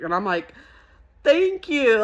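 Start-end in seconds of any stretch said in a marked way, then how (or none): 1.2-2.0 much higher, louder grateful squeal; high, gushing thanks. Retold or imitated. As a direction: thank her, overjoyed. Thanking the PR lady.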